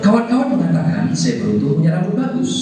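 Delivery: fast shampoo-commercial testimonial; imitated TV advert. Trainer imitates old TV shampoo ad, fast testimonial voice. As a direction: rattle it off like an ad testimonial. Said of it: "suara-suara iklan".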